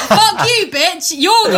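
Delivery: high, loud mock outburst; mock angry retort. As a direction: fire it back in a hammed-up rage. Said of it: "And I was like".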